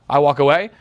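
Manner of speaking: normal talking voice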